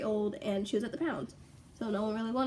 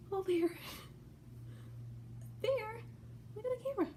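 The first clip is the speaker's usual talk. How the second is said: much higher sing-song coaxing call; calling the puppy; 12 semitones higher, 5 dB quieter than usual. Real, not acted. Owner calling her puppy to look at the camera, high coaxing voice. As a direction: coax the dog with a high pet voice.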